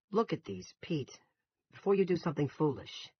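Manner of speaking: high, urgent store manager; agitated protest. A narrator's reading. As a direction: plead loudly against a bad decision.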